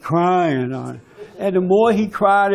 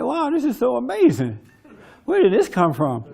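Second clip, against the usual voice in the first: higher-pitched stunned wow; stunned amazement; 6 semitones higher than usual. Retold or imitated. Speaker recalling his amazement at the shows and books, stunned. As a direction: marvel at it as if stunned. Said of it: "I'm stunned".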